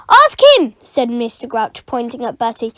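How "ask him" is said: loud high snapped retort; angry retort. Acted. Caretaker to teacher, loud angry retort. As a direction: snap back at her.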